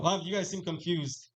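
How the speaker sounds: usual speaking voice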